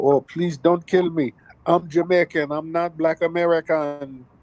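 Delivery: high, pleading mock panic. Mocking a woman begging the police not to kill her, high mock plea. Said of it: "she's on the ground talking about".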